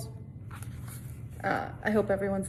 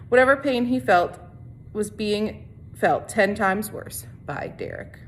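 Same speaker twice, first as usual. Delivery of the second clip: steady read-aloud narration for a class; story narration; 5 dB louder than usual. A narrator's reading. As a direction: read the chapter to the students, evenly. Teacher reads novel to class.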